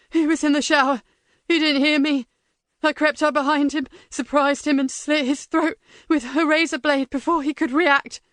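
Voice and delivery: high, frantic prisoner confessing; desperate captive blurting it out; panicked prisoner. Acted.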